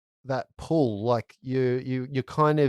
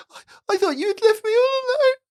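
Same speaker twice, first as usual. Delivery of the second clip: put-on scared little boy; 6 dB louder, faster than usual. GM voices scared crying boy.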